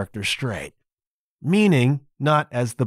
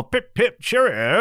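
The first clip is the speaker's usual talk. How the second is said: high-pitched mock English accent; hammed-up stage English, pitched up; 8 semitones higher than usual. Shown as a demonstration. Host mimicking the show's English characters, high mock English accent. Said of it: "the English characters are all".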